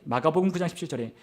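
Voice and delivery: normal talking voice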